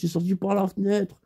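ordinary talk, nothing marked

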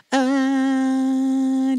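high held "uh" with slight air; sustained note, a little air added; sung middle-ground note. Shown as a demonstration. Voice teacher showing the middle between styles, sung note. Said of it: "there's a slight in the middle".

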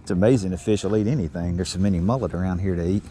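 usual speaking voice

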